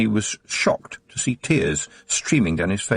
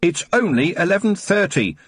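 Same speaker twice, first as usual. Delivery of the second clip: shouted; 8 semitones higher than usual. A narrator's reading. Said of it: "Tiffany shouted after her".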